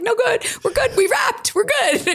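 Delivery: high, bright-pitched quick assurances; giddy reassurance. Retold or imitated. Reassuring the apologetic producers, giddy and high. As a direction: wave them off happily.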